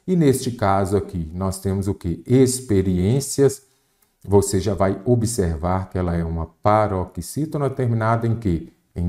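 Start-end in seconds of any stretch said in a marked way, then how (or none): 2.2-3.6 Experiências said slowly, syllables stretched; slow sounding-out. Shown as a demonstration. Teacher asks what we have here, Experiências slow and drawn out. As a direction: draw out Experiências for the class to analyse. Teacher repeats word to analyse it.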